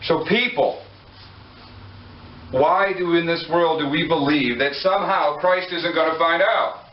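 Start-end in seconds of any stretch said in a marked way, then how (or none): none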